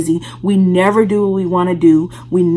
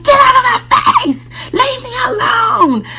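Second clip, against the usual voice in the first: high, loud furious retold yell; angry shout; 4 dB louder than usual. Yelling at hospital staff who greeted her, angry shout. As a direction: scream it at the staff to get out. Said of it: "and I was like".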